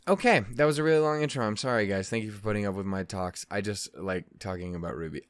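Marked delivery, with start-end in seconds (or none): none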